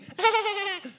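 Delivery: put-on high girlish giggle; high, silly tittering laugh. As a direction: giggle like the woman on a date. Voicing the dating-show woman's giggle.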